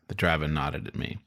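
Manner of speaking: usual speaking voice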